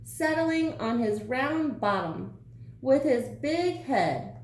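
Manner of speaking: ordinary delivery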